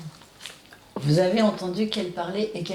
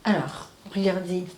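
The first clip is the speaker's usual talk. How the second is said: usual speaking voice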